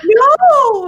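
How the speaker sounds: high, loud wailing no. Retold or imitated. Kid told it will hurt coming out, loud high wail. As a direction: cry out in pure dismay.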